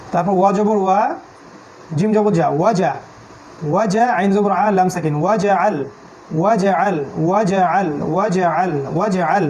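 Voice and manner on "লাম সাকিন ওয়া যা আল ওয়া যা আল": normal talking voice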